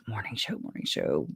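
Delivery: dropping to a near whisper on morning show; muttered to herself. Real, not acted. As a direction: murmur morning show under the breath, almost voiceless. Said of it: "muttering name to herself".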